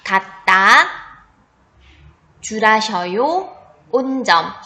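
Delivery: spelling test read; dictating. Shown as a demonstration. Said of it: "문제는 딱 두 번 들려줄 테니까 잘 듣고".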